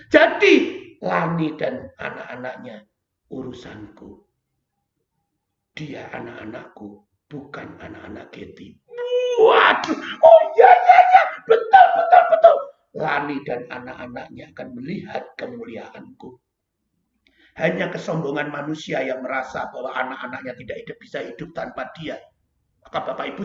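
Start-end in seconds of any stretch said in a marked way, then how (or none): 1.0-8.8 calm voice of God. Retold or imitated. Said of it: "Tuhan ngomong". 9.4-12.6 very high, loud crying agreement. Retold or imitated. Priest overwhelmed by God's answer, crying loudly high. 13.0-20.8 low whisper of a promise; whispered voice of God. Retold or imitated. God promising the priest, whispered low. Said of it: "Tuhan ngomong".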